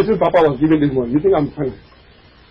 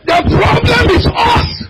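high, slow preacher's shout; shouted climax; 14 semitones higher, slower than usual. Acted. Preacher driving the point home, shouting. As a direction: hit it hard and slow.

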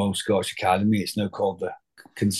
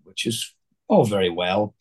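RP accent. Shown as a demonstration. Drama student showing how he used to perform Shakespeare, RP accent. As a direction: lightly put on an RP accent. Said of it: "sort of an R P accent".

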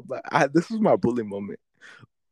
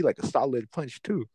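normal talking voice

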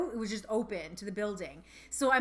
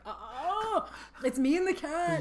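frightened realization; 4 semitones higher, slower than usual. Retold or imitated. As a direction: squeak out the fear, half joking. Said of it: "I'm like".

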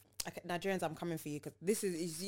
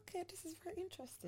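high, quiet, fast mock voice; sarcastic aside; 11 semitones higher, 14 dB quieter, faster than usual. To friend, mocking her own fake-polite reaction, high and hushed. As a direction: murmur it high and fast, faking interest.

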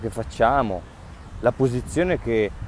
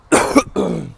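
cough; 8 semitones higher, 6 dB louder than usual. Real, not acted. Speaker coughing mid-podcast.

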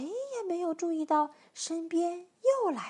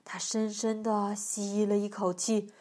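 ordinary delivery, nothing marked

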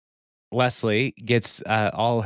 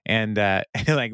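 ordinary talk

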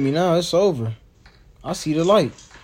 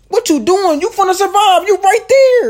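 high, loud excited shouts; excited high yelling; 9 dB louder than usual. Real, not acted. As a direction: cheer her on, squealing.

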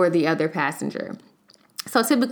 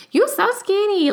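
much higher, louder mocking voice; mock rude remark; 14 semitones higher, 4 dB louder than usual. Imitating people commenting on her body, high mocking voice. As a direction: parody someone's careless comment. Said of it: "don't call me skinny".

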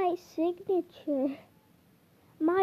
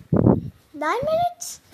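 "Nine minutes?" much higher, slow and rising; mock disbelief; 11 semitones higher, 3 dB louder, slower than usual. Shown as a demonstration. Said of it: "repeats it high".

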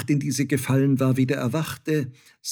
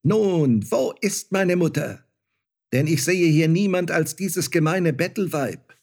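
higher, louder scornful sneer; contemptuous; 8 semitones higher, 5 dB louder than usual. Acted. Star child scorning the beggar mother, contemptuous. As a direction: look down on her with disdain. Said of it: "lachte es verächtlich".

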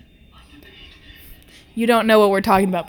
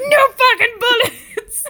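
slow loud high laughing rant; hammed-up indignant shout; laughing fake fury; mock outrage; 13 semitones higher, 8 dB louder, slower than usual. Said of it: "And he's be like".

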